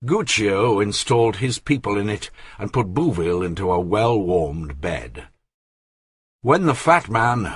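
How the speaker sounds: ordinary delivery, nothing marked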